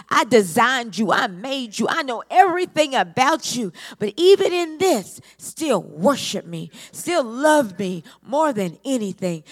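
fervent preacher calling over the congregation; full-force preaching. Acted. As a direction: preach it out to the whole church. Preaching climax, loud and rising.

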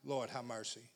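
very low, hushed plea; quiet aside. Real, not acted. Preacher to himself amid the scripture reading, quiet aside. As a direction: murmur it low, almost to yourself.

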